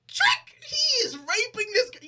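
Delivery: high, loud shocked yell; outraged shout. Retold or imitated. Host acting out the betrayed daughter, shrill shout. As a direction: shriek it in outrage. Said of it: "It's like".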